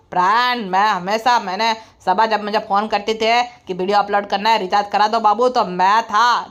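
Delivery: low, droning put-on man's voice; mock deep voice. Mocked husband tells partner to credit his recharges, low smug voice. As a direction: drop the voice low and lecture smugly.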